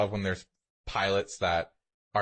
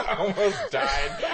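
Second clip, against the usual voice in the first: a higher, slow, laughing repeat; mock the drama while laughing; laughing echo; 8 semitones higher, 3 dB louder, slower than usual. Friend echoes text mockingly.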